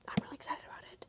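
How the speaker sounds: excited whisper. Real, not acted. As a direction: whisper it like a secret. Whispered aside about a book.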